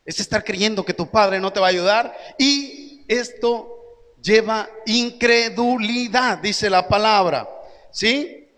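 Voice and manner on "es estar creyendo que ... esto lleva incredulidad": ordinary talk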